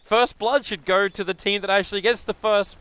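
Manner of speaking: loud, high, emphatic commentary; animated caster explanation. Real, not acted. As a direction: insist on the rule, loud and high. Animated correction during live play-by-play.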